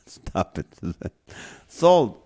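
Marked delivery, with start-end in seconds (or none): 1.7-2.2 high, loud gavel call; auctioneer call. Pretend. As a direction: bang it down like an auctioneer. Auctioneer closing the guessing game.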